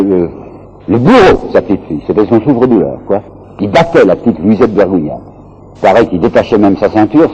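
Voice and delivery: ordinary talk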